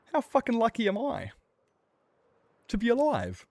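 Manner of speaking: high, loud disbelief; amazed exclamation. Real, not acted. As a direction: marvel at surviving. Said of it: "repeated plainly".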